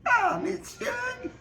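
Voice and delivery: high, slow, bashful whine; embarrassed Mary Agnes. Acted. Mary Agnes blushes at Suge's teasing, high and bashful. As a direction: squirm with embarrassment. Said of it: "say Mary Agnes".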